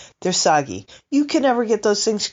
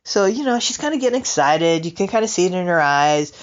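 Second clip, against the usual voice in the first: normal talking voice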